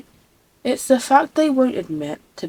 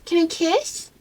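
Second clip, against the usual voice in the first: put-on cutesy baby voice; 8 semitones higher, slower than usual. Repeated in cutesy voice.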